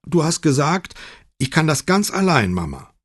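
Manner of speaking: high-pitched insistence, dropping on Mama; Chiara, insisting. A narrator's reading. Daughter reminds her mother of a promise, insisting. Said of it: "beharrte Chiara".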